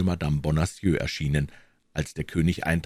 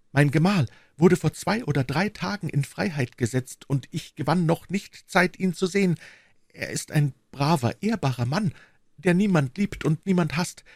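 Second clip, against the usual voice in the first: put-on higher-pitched earnest young woman; devoted young servant woman; 9 semitones higher than usual. Young servant pledges herself to her queen, earnest and devoted.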